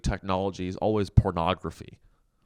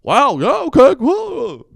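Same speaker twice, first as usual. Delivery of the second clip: much higher, louder, slow stunned reporter voice; 8 dB louder, much slower than usual. Retold or imitated. Mock reporter reacting to the rant. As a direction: play a reporter caught off guard. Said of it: "the New York Times reporter is like".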